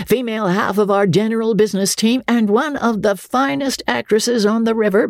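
high, hearty boast; captain's boast. A narrator's reading. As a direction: sell her like a showman. Andy's introduction line.

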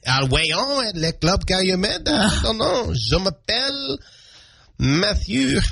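playful, put-on French-Canadian accent. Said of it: "I like to expose my French heritage".

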